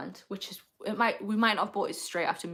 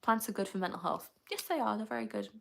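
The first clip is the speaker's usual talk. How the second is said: ordinary delivery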